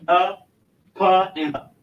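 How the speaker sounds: ordinary talk, nothing marked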